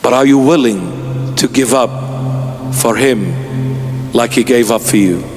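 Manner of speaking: ordinary talk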